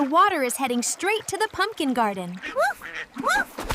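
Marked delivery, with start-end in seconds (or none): 3.2-3.6 two quick barks, much higher; dog barks. Acted. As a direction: yap twice like a happy puppy.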